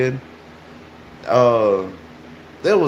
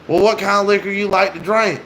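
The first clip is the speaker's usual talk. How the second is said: higher-pitched retold question; mock inviting question, pitched up; imitated coaxing stranger; 8 semitones higher than usual. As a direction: lightly play a stranger angling to tempt.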